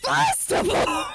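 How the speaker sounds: very high slow squeal of joy; laughing shriek. Real, not acted.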